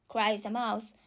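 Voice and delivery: ordinary delivery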